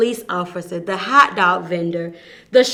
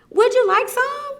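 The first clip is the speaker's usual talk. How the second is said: much higher kindly offer; high sweet voice. Acted. Amu voiced, offering stew.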